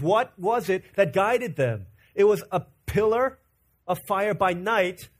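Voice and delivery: rising pulpit fervor; preaching at full force. Acted. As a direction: lean in and preach it home.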